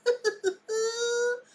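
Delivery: high squealing piglet noise. Pretend. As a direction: squeal like a happy pig. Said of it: "that's my pig impression".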